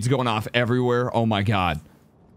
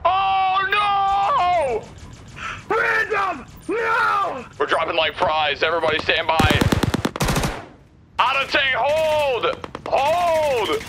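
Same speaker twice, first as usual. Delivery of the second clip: panicked shouting; slower than usual. Real, not acted. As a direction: scream orders as the squad falls. Teammates dying, desperate firefight.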